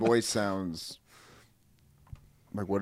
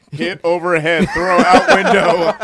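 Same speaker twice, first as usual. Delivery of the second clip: loud mock manual voice; 5 semitones higher, 12 dB louder, slower than usual. Mocking the CIA manual.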